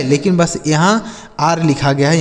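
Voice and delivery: usual speaking voice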